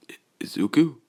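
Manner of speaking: stammered, breathless whisper; startled whisper. Acted. Bakugo startled by Izuku's glare, whispered. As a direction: gasp the name in shock.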